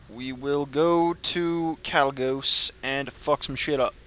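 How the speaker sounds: normal talking voice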